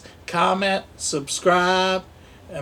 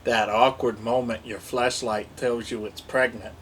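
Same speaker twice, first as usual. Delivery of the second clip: normal talking voice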